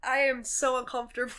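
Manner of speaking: normal talking voice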